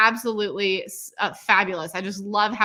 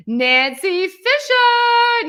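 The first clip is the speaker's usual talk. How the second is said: very slow, much higher and louder name; excited announcement; 12 semitones higher, 9 dB louder, much slower than usual. Real, not acted. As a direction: announce her grandly.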